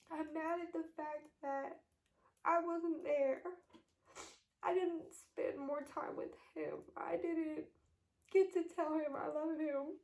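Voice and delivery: holding back tears as the grief rises; on the verge of tears. Real, not acted. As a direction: voice cracking with real grief. Grieving while retelling her father's death.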